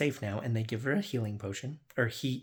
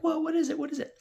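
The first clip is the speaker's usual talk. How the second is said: much higher, alarmed whoa; startled players; faster than usual. Retold or imitated. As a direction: squeak it out like a startled adventurer. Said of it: "So they're like".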